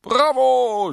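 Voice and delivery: very high, loud cheer; geschrien. A narrator's reading. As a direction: yell it from the audience. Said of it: "schrie jemand". (laut und hoch gejubelt)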